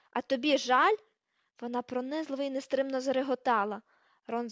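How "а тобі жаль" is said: loud, incredulous question; indignant raised-voice challenge; scornful retort. Acted. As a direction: throw the apology back angrily. Hermione throws his apology back.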